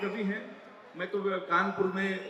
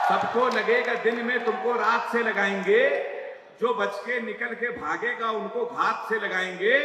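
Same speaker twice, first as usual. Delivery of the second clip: declaimed verse; 4 dB louder than usual. Acted. Poet declaiming the closing verse at the audience, loud. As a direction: hammer the lines home to the crowd. Poem climax to crowd.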